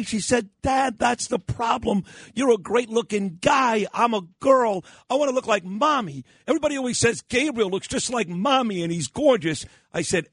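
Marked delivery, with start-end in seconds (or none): 0.6-9.6 retold exasperation, high-pitched; angry daughter. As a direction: whine in protest, pitched high, as an upset girl. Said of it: "she was so angry".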